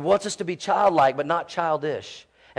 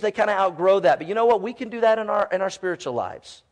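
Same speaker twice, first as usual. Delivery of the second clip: usual speaking voice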